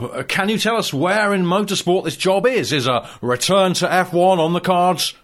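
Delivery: usual speaking voice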